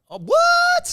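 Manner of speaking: loud, very high shriek of surprise; high-pitched yelp of disbelief; shocked disbelief. Real, not acted. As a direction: blurt it out in real astonishment. Friend says he never left Australia.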